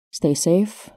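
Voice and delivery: ordinary talk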